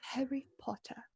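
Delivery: hushed British "Harry Potter", r dropped at the end; British accent. Retold or imitated. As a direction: imitate the British, softly saying "Potta". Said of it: "They go".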